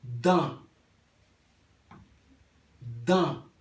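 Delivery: "Dan" with a nasal vowel, N unsounded; dan en criollo. Shown as a demonstration. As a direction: end "Dan" in the nose. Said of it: "La D". ("Dan" con A nasal, sin N)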